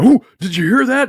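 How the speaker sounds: high, loud, quick mock alarm; mock startled. Ghost hunter hearing something drop, mock startled. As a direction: imitate an over-excited ghost hunter jumping at noises.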